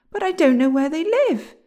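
put-on high, loud boyish objection; object, pitched high and loud; protesting. A narrator's reading. William protests to the dwarf.

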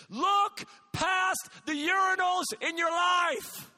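loud, high pulpit exhortation; full-force preaching. Acted. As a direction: drive it home to a generation. Preaching climax to young people.

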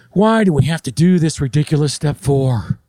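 exasperated, whiny complaint; mock annoyed. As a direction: complain as a fed-up employee, hammed-up. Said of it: "instead of saying something like".

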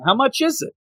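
high, eager what; excited disbelief. Retold or imitated. Deadpool asking about the check amount, excitedly high. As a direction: pitch up, greedy and amazed.

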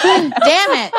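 playful, high "Damn it" as if cheated of a treat; mock disappointment. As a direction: groan it like a kid who just lost out. Host jokingly denied an accent.

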